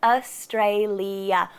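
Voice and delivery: "Australia" very slow, four clear syllables; slowed demo. Shown as a demonstration. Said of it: "with four syllables".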